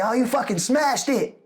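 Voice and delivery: high, excited praise; impressed praise. Retold or imitated. Ren praising him after the shoot, high excited voice. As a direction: tell him he nailed it. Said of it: "And he was like".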